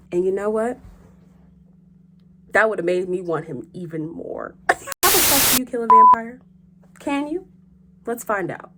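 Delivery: normal talking voice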